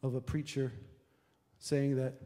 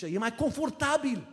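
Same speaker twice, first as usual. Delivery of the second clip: loud, high hammed-up tempter; mocking devil; 11 semitones higher, 7 dB louder than usual. Devil tempting churchgoers to skip Sundays, mock loud. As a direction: sneer like the devil selling comfort. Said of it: "parcă cel rău zice".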